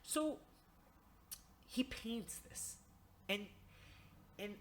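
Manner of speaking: ordinary talk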